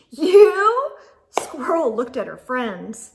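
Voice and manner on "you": high, loud disbelieving squeak; incredulous. Acted. Squirrel looking at her unlikely friends, loud disbelief. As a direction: scoff at the very idea.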